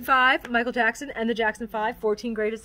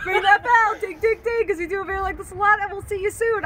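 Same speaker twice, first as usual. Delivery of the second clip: hyped outro; 8 semitones higher, 5 dB louder than usual. Real, not acted. Energetic outro.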